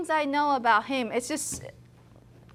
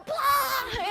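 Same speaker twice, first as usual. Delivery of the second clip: mock battle cry; 11 semitones higher, 4 dB quieter than usual. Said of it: "Larry would go".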